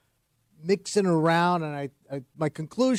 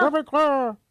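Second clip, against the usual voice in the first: mock echo; 10 semitones higher, slower than usual. Mimicking host's phrase.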